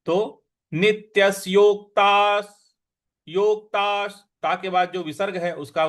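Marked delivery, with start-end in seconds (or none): 0.7-4.3 योक्तास said loud and slow, half क pressed; stress on half k. Shown as a demonstration. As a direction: lean on the क in योक्तास. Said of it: "उस पर भी हमको आघात देना है".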